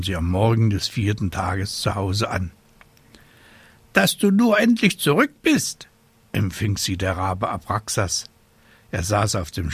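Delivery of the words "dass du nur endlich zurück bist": high, loud scolding bird voice; fussy raven. Acted. Raven to the witch at last home, high-voiced scolding. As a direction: squawk it as an impatient raven.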